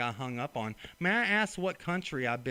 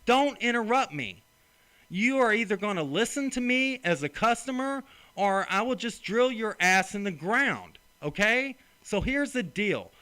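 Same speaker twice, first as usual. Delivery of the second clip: loud, higher, furious telling-off; angry yelling; 5 semitones higher, 5 dB louder than usual. Real, not acted. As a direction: shout him down, real fury. Furious customer berates phone agent.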